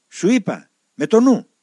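high, loud annoyed rebuke; the elder rebukes the novice for praying aloud, loud and high. Retold or imitated. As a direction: snap it at the boy through the wall.

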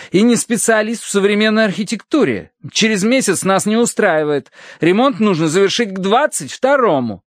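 high-pitched, insistent retort; indignant clergyman. A narrator's reading. Clergyman demands repairs by the deadline, indignant. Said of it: "ещё больше выкатил глаза священник".

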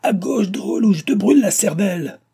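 loud, very high, fierce yell; furious high-pitched command; terrible shouted threat. A narrator's reading. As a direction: threaten the driver at full urgency. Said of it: "cria-t-il au second postillon".

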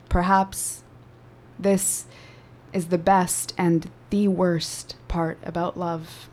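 measured, intimate poetry performance; spoken word poem. Acted. Poem continues after interjection.

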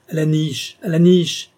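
furious scolding, second cry stronger; angry order. A narrator's reading. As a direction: order the dog off, building in force. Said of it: "toute sa colère ... le reprit".

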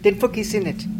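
ordinary talk

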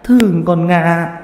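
put-on a grieving mother begging the boy to look for her daughter; tearful begging. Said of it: "mà lạy lục".

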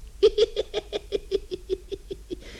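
breathless evil witch cackle, all air. Acted. Witch gloating over her plan, hissing cackle. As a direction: cackle wickedly under your breath.

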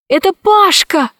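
high, happy cry; delighted to see him; joyful. Acted. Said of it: "радовалась Алиса".

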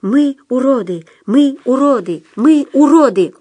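high, forceful drilled chant; chorus report. A narrator's reading. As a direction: shout back like an obedient crowd. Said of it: "Толпа хором отрапортовала".

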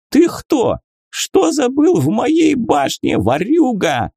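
a tipsy wizard yelling at a thief; drunk shout. Acted. Drunk archmage spots intruder.

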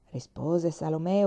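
ordinary delivery, nothing marked

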